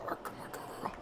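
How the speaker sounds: ordinary talk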